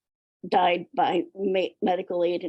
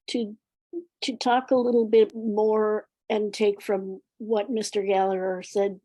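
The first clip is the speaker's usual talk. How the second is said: ordinary talk, nothing marked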